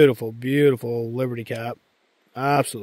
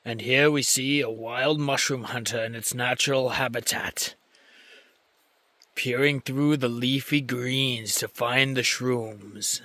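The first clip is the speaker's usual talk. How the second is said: nature documentary; 5 dB quieter than usual. Pretend. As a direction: narrate like a quiet nature film. Narrates himself like documentary.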